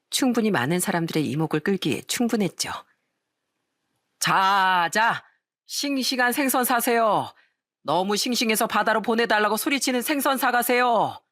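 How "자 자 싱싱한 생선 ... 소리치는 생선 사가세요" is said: ringing hawker's call. A narrator's reading. Father hawking fresh fish in a noisy market, ringing call. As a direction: sing out the sales pitch to the whole market. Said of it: "쩌렁쩌렁하게 울리는 아버지의 목소린".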